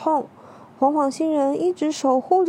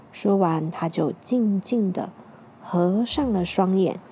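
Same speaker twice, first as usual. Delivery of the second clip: ordinary delivery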